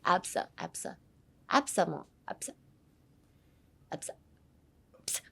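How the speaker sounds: 'absa' as a soft sneeze, vowels barely voiced. Shown as a demonstration. Teacher drilling learners, 'absa' like a small sneeze. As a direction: puff out 'absa' softly like sneezing. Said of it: "くしゃみみたいな".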